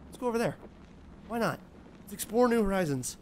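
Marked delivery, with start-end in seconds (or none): none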